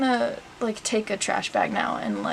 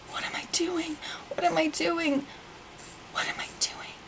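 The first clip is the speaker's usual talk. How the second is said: real, barely voiced self-questioning; stunned whisper; 6 semitones higher, 10 dB quieter than usual. Real, not acted. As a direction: whisper to yourself in disbelief. Stunned at cutting own hair.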